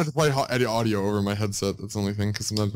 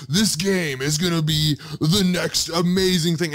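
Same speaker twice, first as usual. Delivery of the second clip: hype presenter; 4 semitones higher than usual. Retold or imitated. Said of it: "It's Ubisoft saying".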